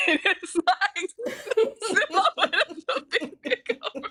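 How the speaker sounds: high, slow words broken by laughter; giggling too hard to finish; laughing. Real, not acted. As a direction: crack up while describing it. Laughing describing meme.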